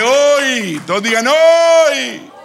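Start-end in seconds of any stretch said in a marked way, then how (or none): 0.0-0.8 loud, high cry to the congregation; ringing pulpit exclamation; preacher's call-out. Real, not acted. 1.3-2.3 very high, loud preacher's shout; full-voiced pulpit cry; rallying shout. Real, not acted. Leading congregation to say it.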